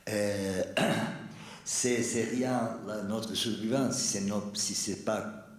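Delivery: Italian accent in French; searching French, Italian accent; foreign accent in french. Real, not acted. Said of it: "Excusez-moi, mon français, c'est terrible".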